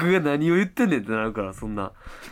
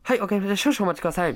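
higher-pitched customer-service voice; polite shop clerk; 6 semitones higher, much faster than usual. Retold or imitated. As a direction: chirp it like a clerk taking an order.

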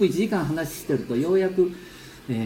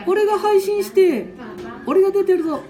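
put-on high, playful little-boy voice; squeaky grandkid voice; child voice; 4 dB louder than usual. Grandpa voices child Ken-chan.

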